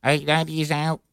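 mock line call as a computer. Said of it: "Can you do him in a computer voice? Try it again".

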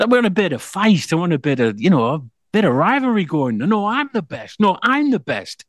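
high, boastful mock trash talk; cocky player voice. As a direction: brag like a rival player. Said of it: "he's like".

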